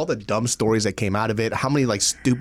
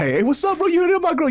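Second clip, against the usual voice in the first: mock tough guy.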